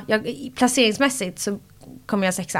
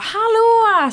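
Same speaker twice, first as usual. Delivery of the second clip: much higher, louder "Hallå!" called out gladly; happy greeting; 14 semitones higher, 6 dB louder than usual. Retold or imitated. Walking in on her friends after the final, calling out thrilled. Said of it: "Och jag bara".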